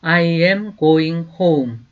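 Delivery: slow, clear teacher's model, each word apart; slow demo pronunciation; slow model sentence. Shown as a demonstration. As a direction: say it slowly for students to repeat. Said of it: "나는 집으로 가고 있는 중이야".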